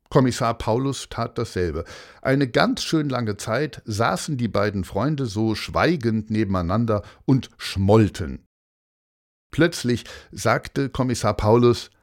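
plain reading voice

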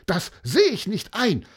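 loud, high-pitched refusal; angry protest. A narrator's reading. Boy refuses to accept defeat, angry protest. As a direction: refuse furiously, like a wronged boy. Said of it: "Er wurde richtig wütend".

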